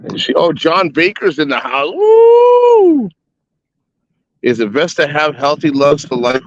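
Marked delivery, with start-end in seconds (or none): 1.9-3.1 loud high "woo"; high excited whoop. Real, not acted. Greeting a visitor in chat.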